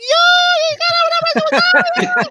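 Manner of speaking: squeaky excited high voice; voice goes up. Real, not acted. Friend getting hyped about a movie, high squeal. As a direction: pitch shoots way up, loud and laughing. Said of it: "my, my voice goes up".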